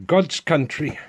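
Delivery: hammed-up Welsh lilt, badly done; terrible Welsh accent. Vlogger mocking his own bad Welsh accent, hammed-up Welsh. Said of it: "that's a terrible Welsh accent".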